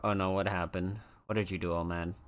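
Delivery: ordinary talk, nothing marked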